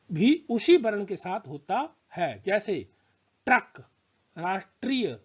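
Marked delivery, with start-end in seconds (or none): 3.4-5.2 ट्रक and राष्ट्रीय said with ट and र joined together; teacher modelling the ट्र cluster; demo words. Shown as a demonstration. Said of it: "ट और र साथ में उच्चारित हो रहे हैं".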